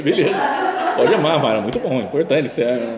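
laughing, placating reply; amused humouring. Retold or imitated. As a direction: humour the shouter through laughter. Humouring reply to the shouting man.

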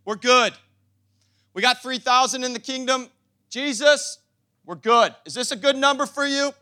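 loud, mock-satisfied boast; hammed-up smug believers patting themselves on the back; smug complacent church. Pastor mocks a complacent church: loud smug boast. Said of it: "didn't preach to three thousand and say".

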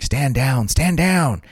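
high, rising urgent "stand down"; mock urgent order. Veterans pulling two actors apart, high urgent command. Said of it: "are like".

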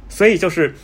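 ordinary talk

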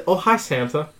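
a hint of Tommy Wiseau's odd lilt; mild Wiseau imitation; Tommy Wiseau impression. Shown as a demonstration. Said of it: "Why are you saying it like that?".

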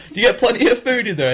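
high, loud mock voice of the man filming; imitating a cameraman. Man filming quizzing the guy in the bin, loud and high. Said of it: "and he goes".

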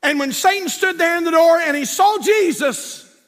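high, loud storytelling preacher; preaching at full force. Acted. Climax of the story.

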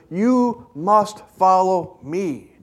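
slow, every word weighted; slow solemn command. Acted. Jesus commanding Peter, slow and solemn. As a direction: lay it down like a verdict.

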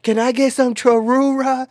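put-on high, loud drunken call; hammed-up drunk friend calling out high; imitated drunk order. Drunk friend orders after shushing car, high mock yell.

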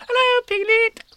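very high, loud squeak; squeaky Roo. Acted. Roo squeaking a greeting to Pooh at the party, very high and loud. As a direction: squeak it like an excited baby kangaroo. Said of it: "he squeaked".

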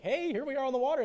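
high, loud, chirpy mock cheer; carefree greeting. Carefree disciple greeting Jesus out on the water, mock cheerful. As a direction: call out a breezy hello over the waves.

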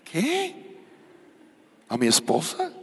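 squeaked high disbelief on "¿Qué?"; mock outrage, voice jumping high; shocked. Shocked reaction retold. (sorpresa chillona y exagerada)

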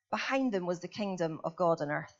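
usual speaking voice

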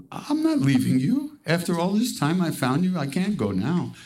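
high, insistent plea not to part; cling to him with your voice; pleading. Retold or imitated. Sadhu begging Maharaj not to leave him, high pleading.

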